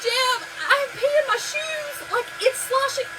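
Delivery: high slow laugh-cry to Jim; laugh-crying quote. Retold or imitated. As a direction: wail it to her husband while cracking up. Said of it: "And I was like".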